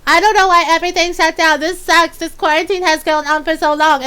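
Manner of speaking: hammed-up whining; whiny complainer. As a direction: imitate someone moaning about quarantine. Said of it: "they turn around and complain and go".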